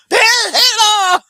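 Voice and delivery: high, loud, slow cry of alarm; hammed-up scream pointing at a ghost; mock panicked shout. Mocking a jumpy Israel, a loud panicked shout.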